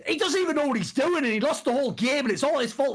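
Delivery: a hammed-up take on Reginald's whining; imitating Reginald blaming. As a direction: mimic a coach blaming his player. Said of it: "Reginald's just going".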